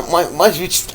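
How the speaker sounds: ordinary talk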